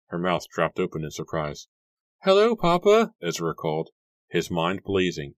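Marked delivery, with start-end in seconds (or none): 2.2-3.1 put-on very high, loud child's call. A narrator's reading. Little Ezra calling out to his father, high child's voice. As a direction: call out brightly as the little boy.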